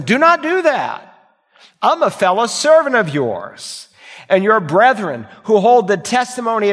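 a messenger forbidding them firmly; angel's rebuke. Retold or imitated. Preacher voices angel's rebuke.

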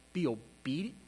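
usual speaking voice

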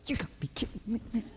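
very quiet, quick grumble, imitating his son; mimicked aggravated muttering. Father imitating son copying him after a missed shot, muttered grumble. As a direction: mimic a kid grumbling under his breath. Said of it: "And Luke did this".

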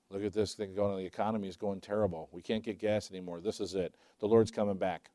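preacher imitating doom talk from his teenage years, low and soft; mock worried old talk. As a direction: play the fretful crowd of years ago. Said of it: "I remember hearing that".

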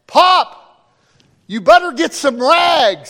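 high, loud call across the oil rig; shouting to Pop. Retold or imitated. Son shouting to his dad on the rig, loud call. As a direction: yell for him to grab rags.

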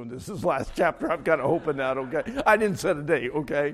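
loud, mock-defensive preacher; big, playful protest; joking insistence. Real, not acted. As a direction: punch it out over the laughter.

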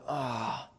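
soft grumbling "ah"; low-tone sigh of displeasure. Acted. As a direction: let out a quiet, dissatisfied sigh. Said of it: "that low tone of".

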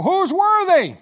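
high, loud heavenly cry; angel crying out. Acted. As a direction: cry it out over all heaven. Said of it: "the angel cries out".

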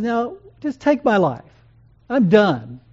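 weary despair. Retold or imitated. Jonah telling God he's done, lightly voiced exasperated despair. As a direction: throw up your hands, done with it all.

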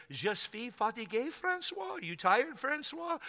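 high, sing-song teacher voice with a lilting Francois; imitating French teacher. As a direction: mimic a sweet kindergarten teacher calling Francois. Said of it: "she would say to me".

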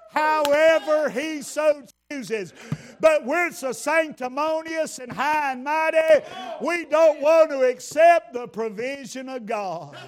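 loud, high preaching shout with a whoop; preaching at full force. Acted. Preacher rousing the congregation about God's provision, full shouted preaching. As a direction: lift the church with a shouted whoop.

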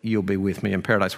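soft, low reassurance; gentle forgiveness. Retold or imitated. Jesus answering the criminal beside him, gently and quietly. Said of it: "offers him words of forgiveness".

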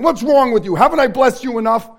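loud, exasperated scolding from heaven; mock-scold like a disappointed God; stern God rebuke. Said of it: "Thank you that you're not in heaven saying".

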